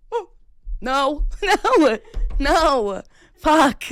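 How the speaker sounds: high, loud, laughing protest; exasperated shout. Real, not acted. Storyteller reacting to his yes to a threesome, exasperated shouting. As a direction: cry out no in disbelief.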